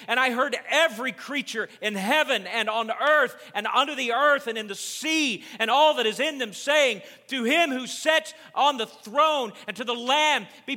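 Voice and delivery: high, loud, impassioned sermon shout; full-force preaching. Real, not acted. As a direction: drive every phrase home at full volume.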